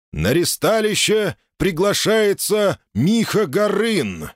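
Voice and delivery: slow, high booming announcement; town crier. Acted. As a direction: call it out like a herald. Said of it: "снова голос глашатая".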